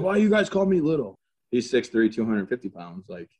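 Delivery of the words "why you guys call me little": high, louder mock protest; imitated indignant complaint. Big soldier objects to his nickname, imitated high-pitched protest. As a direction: jump up in pitch, playfully aggrieved.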